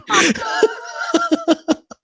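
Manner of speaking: high helpless cackle; real high-pitched laughing fit; laughing hard. Real, not acted. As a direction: burst out in a long high laugh. Cracks up at the name joke.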